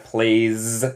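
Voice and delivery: plays ending in a held zzz; buzzing final S. Shown as a demonstration. Teacher repeats the bee sound for students, buzzing final S. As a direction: buzz the end of "plays" like a bee. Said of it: "también como abeja".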